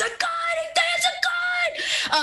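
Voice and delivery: very high, drawn-out mock scream; high strained cry, words stretched out; panicked bystander scream. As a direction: shriek the alarm from a panicking crowd. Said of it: "a woman screams, somebody points".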